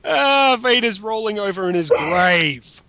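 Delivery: high and loud, still laughing; laughing through it. Real, not acted. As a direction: get the words out while cracking up. Laughing at Death Star form.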